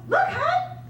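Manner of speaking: bubbling over with laughing pride; giddy brag. Retold or imitated. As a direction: crow about the new car. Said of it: "I go".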